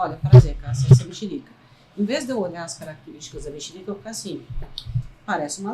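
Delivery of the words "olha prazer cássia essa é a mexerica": loud, announcing a newcomer; a hammed-up loud greeting; mock formal introduction. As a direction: boom it out like a showy introduction. Acting out meeting a new thing.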